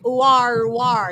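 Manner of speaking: a quick mock West Country "ooh-ah". Host teasing a guest about his accent, mock West Country. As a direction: tease him with a West Country burr. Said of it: "the West Country".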